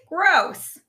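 high, loud disgust; disgusted exclamation. A narrator's reading. Rabbit mishears Robot's big word, loudly disgusted. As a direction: recoil loudly at something icky.

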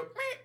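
high squeaky honk imitation; toy squeak. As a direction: squeak like a squeezed dog toy. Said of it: "the legs go".

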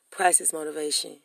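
ordinary talk

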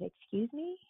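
high, startled excuse me; surprised disbelief. Retold or imitated. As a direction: pitch it up in shock. Retold disbelief at job offer.

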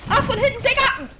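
high loud outraged yell; outraged shout. Real, not acted. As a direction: yell at the sneak attack. Attacked from behind in game.

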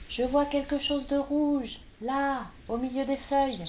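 petite voix très aiguë; voix de lapin. A narrator's reading. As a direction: lapin excité qui montre. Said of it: "s'écria un des lapins". (le lapin montre le rouge, voix aiguë)

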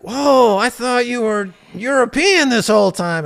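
loud, high-pitched mock astonishment; astonished. Lunch companion learns he misjudged them, astonished. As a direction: act out someone completely taken aback.

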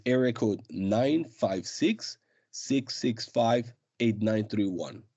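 slow, careful number read-out; over enunciating. Shown as a demonstration. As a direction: read the digits slowly and clearly. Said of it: "give us that phone number one more time".

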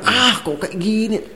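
high, drawn-out grumble of complaint; mock angry. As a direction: act out an exasperated outburst, softly and slowly. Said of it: "Marah deh kan".

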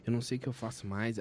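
fast, low, quiet lament; quiet retold despair; despairing mutter. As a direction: rush it out under the breath.

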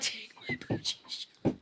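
ordinary talk, nothing marked

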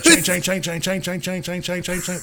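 high, fading chain chain chime; imitated chime. Retelling the car alarm, high fading chime. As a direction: mimic the chime, softening as it runs. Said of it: "And it's like".

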